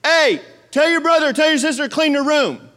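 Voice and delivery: mock parent shout; yelling at kids. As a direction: call the kids from across the house. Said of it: "when I yell at my kids".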